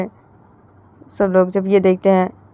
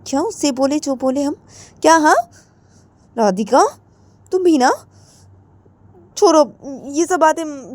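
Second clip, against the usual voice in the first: dismissive sister; 5 semitones higher than usual. Acted. Elder sister brushing off the quarrel, easing down. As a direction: brush the fight aside.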